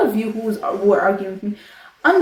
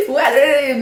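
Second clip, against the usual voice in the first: laughing; 6 semitones higher, 6 dB louder than usual. Real, not acted. Laughs at imagined commenters.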